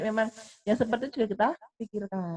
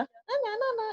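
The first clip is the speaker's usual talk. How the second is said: imitated child; 12 semitones higher, slower than usual. Voices the delighted child.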